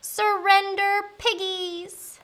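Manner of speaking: high, drawn-out command; slow, high witchy summons; witch calling out. Acted. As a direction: call down to your prey, stretching the words.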